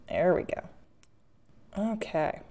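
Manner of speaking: ordinary talk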